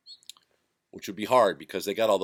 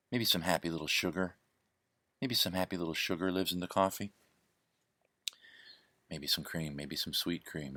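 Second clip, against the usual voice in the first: Bob Ross narrating; 6 dB quieter than usual. Retold or imitated. In the kitchen, narrating his mug like Bob Ross, hushed. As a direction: narrate your hands like a gentle painter. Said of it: "I found myself narrating my hands".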